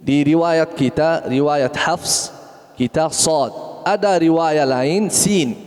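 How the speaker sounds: ordinary delivery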